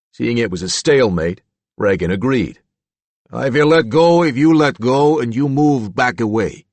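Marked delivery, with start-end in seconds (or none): 3.3-6.7 put-on a measured Slavic accent, a bit louder. A narrator's reading. Reagan bargains with Roop in a Slavic accent. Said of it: "that Slavic accent".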